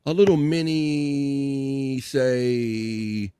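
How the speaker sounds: drawn-out hype build; hype host voice. Acted. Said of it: "let me find my element here".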